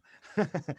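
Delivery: high loud burst of real laughter. Real, not acted. Guest laughing at his own closet studio, loud high laugh.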